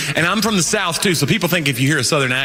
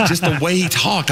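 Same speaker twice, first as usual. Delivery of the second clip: ordinary talk, nothing marked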